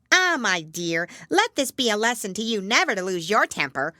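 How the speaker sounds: put-on loud, preachy old mother; old crab. A narrator's reading. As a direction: lecture her daughter as the old crab. Old crab lectures daughter.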